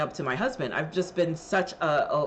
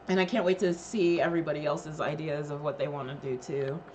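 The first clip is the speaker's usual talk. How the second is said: usual speaking voice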